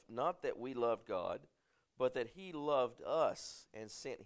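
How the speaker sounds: measured pulpit reading of scripture. Acted. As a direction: read the Bible passage with weight. Reads scripture aloud to congregation.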